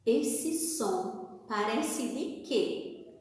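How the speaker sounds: ordinary delivery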